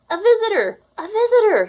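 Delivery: high squeaky mouse cry, loud and urgent; tiny mouse calling the alarm, high and loud. A narrator's reading. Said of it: "he cried".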